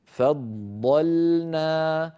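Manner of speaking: فضلنا very slow, light ف, heavy emphatic ض, light ل; tajweed demonstration. Shown as a demonstration. Teacher showing students فضلنا with emphatic ض, very slowly. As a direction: stretch فضلنا out, ض thick and emphatic between light letters. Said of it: "فننطقها بهذا الشكل".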